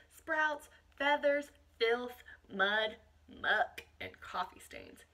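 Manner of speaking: put-on reading a picture book aloud to children; expressive read-aloud, savouring each list item; picture-book narration for a class; storybook reading.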